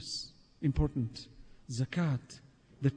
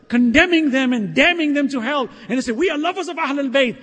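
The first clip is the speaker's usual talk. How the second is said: raised, forceful rebuke from the pulpit; impassioned preaching; 7 semitones higher, 8 dB louder than usual. Real, not acted. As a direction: challenge the congregation with force.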